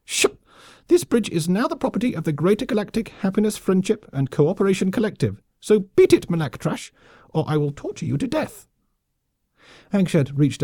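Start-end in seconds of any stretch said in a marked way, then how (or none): 0.1-8.7 high, squeaky officious threat; pompous lemming officer. A narrator's reading. Colonel claiming the bridge from the offworlder, pompous. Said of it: "insufferably pompous as well".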